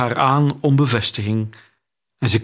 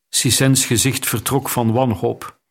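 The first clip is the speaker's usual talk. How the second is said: plain reading voice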